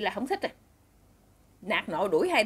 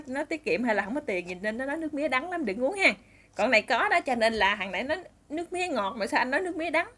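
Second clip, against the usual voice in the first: ordinary delivery, nothing marked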